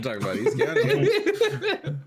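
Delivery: high, slow, laughing put-on voice; mock high echo. Friend echoes the line in a silly high mocking voice.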